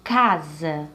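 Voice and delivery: "Casa" slowly, with a long strong "Ca"; stress on first syllable. Shown as a demonstration. Teacher repeating a word for the class, first syllable stressed in "Casa". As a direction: stretch the "Ca" in "Casa", let the ending drop. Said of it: "Vamos repetir".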